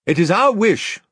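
high, loud regal pronouncement; Caspian, commanding. A narrator's reading. Young king addressing the slack captain, commanding. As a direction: declare it with royal authority.

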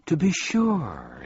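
high, drawn-out proud reply. A narrator's reading. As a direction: announce it slowly with pride. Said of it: "he replied proudly".

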